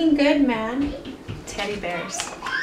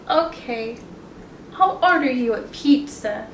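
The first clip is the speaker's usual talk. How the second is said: ordinary delivery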